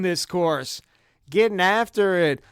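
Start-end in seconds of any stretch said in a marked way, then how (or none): none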